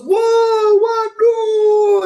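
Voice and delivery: high, loud mock crowd outcry; stretched high gasps of disbelief; shocked crowd. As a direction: cry out like stunned spectators. Crowd reacts to the upset.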